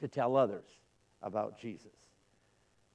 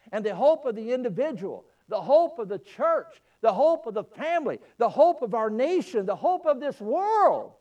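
preaching build; 6 semitones higher, 6 dB louder than usual. Acted. Preaching climax.

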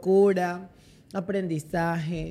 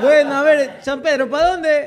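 higher, louder, laughing voice of a confused dead man; laughing mock pedestrian at heaven; 7 semitones higher, 9 dB louder than usual. Friend voices the dead man asking Saint Peter where to go, laughing and loud. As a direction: call out laughing like a lost soul asking the way. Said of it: "Me lo imagino ese señor".

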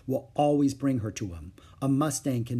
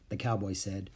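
ordinary delivery

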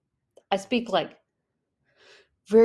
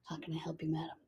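mock whispered clerk; 3 semitones lower, 16 dB quieter, faster than usual. French clerks' reply imitated.

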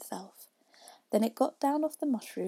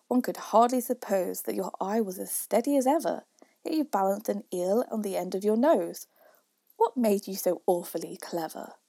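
louder, rhythmic verse; poem recitation; 6 dB louder than usual. A narrator's reading. Youth questions Father William, in loud recitation. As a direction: recite it out with a firm beat.

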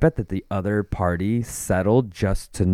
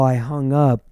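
ordinary talk

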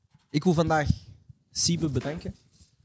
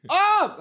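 startled cry; 13 semitones higher, 5 dB louder than usual. Real, not acted. Startled cry at near mistake.

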